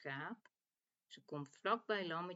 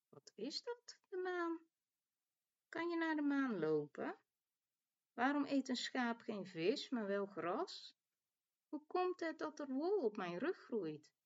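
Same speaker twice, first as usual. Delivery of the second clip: lightly voiced lamb, higher pitch; curious little lamb; 7 semitones higher than usual. A narrator's reading. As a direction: wonder aloud in a higher little voice. Lamb's wondering questions in story.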